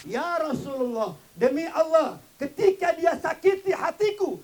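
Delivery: loud, high, slow cry; anguished oath. Acted. Mother telling the Prophet her son hurt her, anguished. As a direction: pour out the hurt.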